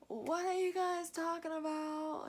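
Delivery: mock drugged-up call to the family. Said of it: "I sounded all drugged up".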